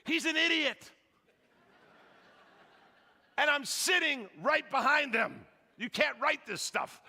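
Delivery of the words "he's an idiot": a hammed-up outraged lady sneering at the pastor; indignant lady. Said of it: "and I quote".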